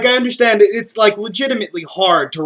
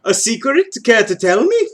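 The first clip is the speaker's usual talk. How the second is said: higher, rising questioning tone; pitched-up curious asking; spoken question; 6 semitones higher than usual. Acted. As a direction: ask "A secret?" high, lifting at the end. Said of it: "so he thought that to himself".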